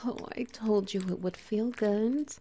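normal talking voice

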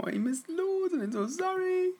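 very high, drawn-out squeal; playful high-pitched sorry; squeaky apology. Real, not acted. Sing-song squeaky apology to listeners.

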